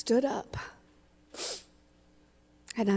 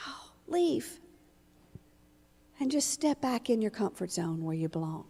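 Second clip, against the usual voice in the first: hushed, sneering inner voice, much softer; nagging inner critic; 7 dB quieter than usual. Retold or imitated. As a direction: hiss the doubts like a voice in your head. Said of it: "this one voice in my head is like".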